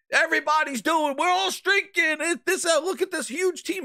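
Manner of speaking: high, squeaky boasting voice; mocking boast. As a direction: ham up a proud boaster. Mocks Phil's boasting.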